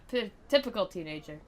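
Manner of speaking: ordinary talk, nothing marked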